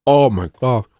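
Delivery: puckering at a sour tablet; eating sour candy. Real, not acted. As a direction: talk through a sour mouthful. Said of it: "that's sourer than anything".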